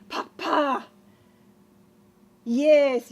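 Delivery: ordinary talk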